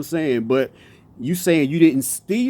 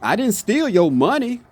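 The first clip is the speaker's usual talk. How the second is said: higher overall, money set apart; stress on money; 6 semitones higher than usual. Shown as a demonstration. Showing viewers what was stolen, stress on money. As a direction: lift the sentence and single out money. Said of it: "Same sentence now".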